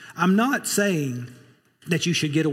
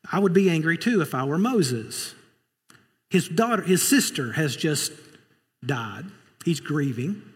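ordinary talk, nothing marked

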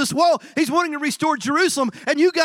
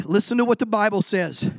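ordinary delivery

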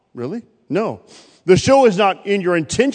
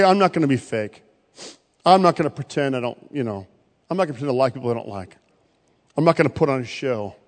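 mock excuse-maker; 7 semitones lower, 5 dB quieter, faster than usual. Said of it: "some of you say".

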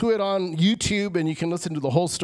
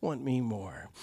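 intimate plea; 6 semitones lower, 7 dB quieter, slower than usual. Retold or imitated. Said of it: "Jesus is saying".

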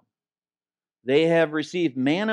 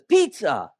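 louder, higher, sing-out mom voice; mom announcing dinner; 5 semitones higher, 5 dB louder than usual. Acted. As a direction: call it out like a mother serving dinner. Mom's cheery dinner announcement, acted.

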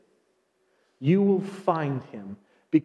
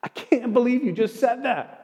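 high, tearful imitated girl; tearful disbelief; 8 semitones higher than usual. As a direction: voice her crying in disbelief. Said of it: "she just starts to cry".

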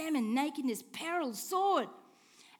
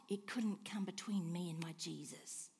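hushed, sincere whisper, lower; whispered confession; 8 semitones lower, 8 dB quieter than usual. Real, not acted. Pastor recalling his father's death, whispered. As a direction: whisper it like a secret faith.

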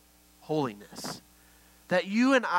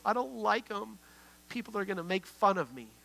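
mildly tearful mimicked child, higher pitch; imitating tearful child; 4 semitones higher, 4 dB quieter than usual. Young daughter protesting to her dad, imitated crying. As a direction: play a child's upset complaint, lightly. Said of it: "she just starts crying".

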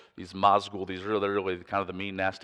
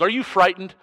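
Aragorn; 11 semitones higher, 10 dB louder than usual. Retold or imitated. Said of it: "and he goes".

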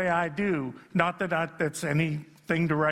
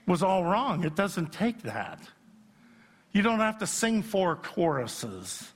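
ordinary delivery

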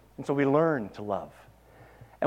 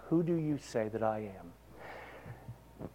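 hushed Jesus question; 4 semitones lower, 10 dB quieter, much slower than usual. Acted. Jesus quoted asking disciples.

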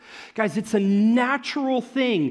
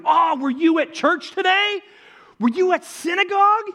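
higher and louder, eager questions; bursting to tell the news; excited friend; 10 semitones higher, 6 dB louder than usual. Acted. A friend eagerly asks Todd about synagogue, excited and loud.

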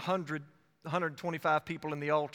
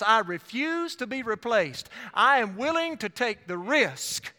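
defiant declaration; 4 semitones higher, 3 dB louder than usual. Acted. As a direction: preach it as Ananias, higher and emphatic. Acts out Ananias refusing replacement.

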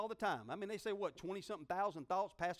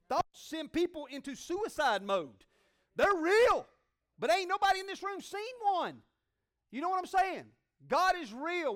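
loud, high preacher's cry; fiery preaching; 9 semitones higher, 10 dB louder than usual. Real, not acted. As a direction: preach it hard to the room. Preaching at full force.

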